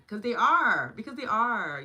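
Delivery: loud, high insistence on are; emphatic. Real, not acted. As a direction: hammer it home twice. Emphatic repeated affirmation.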